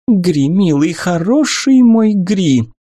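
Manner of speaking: high, slow, enveloping coo; caressing. A narrator's reading. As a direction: purr it sweetly, drawing him in. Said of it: "Голос журчал, ласкал, обволакивал". (ласково, обволакивающе)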